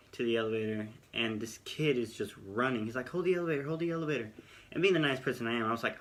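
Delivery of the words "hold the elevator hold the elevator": mock call out. Kid running calls to hold elevator.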